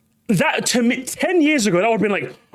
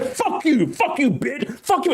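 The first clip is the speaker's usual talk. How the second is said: put-on higher-pitched kid trash talk; mock teenage voice-chat heckling; mouthy teenage troll; 7 semitones higher than usual. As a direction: spit insults like a little brat. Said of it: "I was a fifty-year-old little shit".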